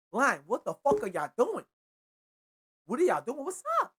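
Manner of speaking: exasperated, rising yell at the line; mock yell at the linemen. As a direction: chew out the linemen, voice climbing. Yelling at his own line.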